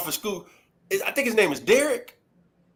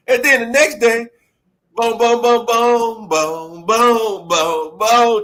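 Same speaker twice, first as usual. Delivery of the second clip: mimicked beat; 5 semitones higher, 5 dB louder, much slower than usual. Imitating loud beat in class.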